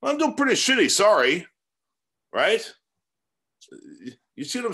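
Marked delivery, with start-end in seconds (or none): 0.0-1.5 mock irritated retort. Imagined annoyed retort to caller.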